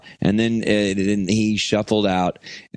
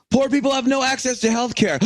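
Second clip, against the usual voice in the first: high, straining put-on cry; mocking shouted complaint; faster than usual. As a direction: wail it high like a protest slogan. Acted scream during climax.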